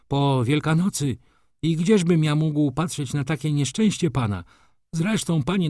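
high-pitched, pleading railwayman; earnest railwayman. Acted. Railwayman explains himself to the gentleman, earnest pleading. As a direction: explain yourself in a high, anxious voice.

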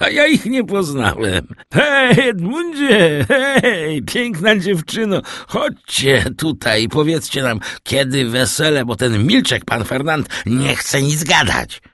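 put-on high, boisterous drunken calling; tipsy Kaderus, hailing. A narrator's reading. Drunk Kaderus calling the lovers over, high boisterous voice. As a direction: call out high, swelling on the hails.

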